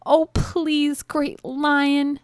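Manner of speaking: high, pleading little mouse; high-pitched storybook begging. A narrator's reading. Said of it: "begged the mouse".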